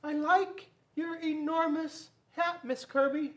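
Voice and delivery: put-on high, slow child's voice; awkward boy. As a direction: compliment her awkwardly. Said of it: "Bobby said".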